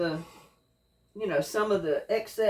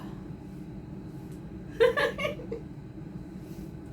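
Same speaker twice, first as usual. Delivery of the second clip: high airless giggle; breathless laugh; 6 dB quieter than usual. Real, not acted. Cook laughing at the stinging fumes, breathless. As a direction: wheeze out a silent laugh.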